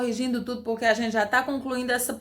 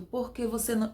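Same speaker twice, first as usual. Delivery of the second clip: usual speaking voice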